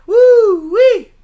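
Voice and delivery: very high, very loud whoop; excited whoop. Real, not acted. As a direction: holler it with glee. Excited over prison commodity.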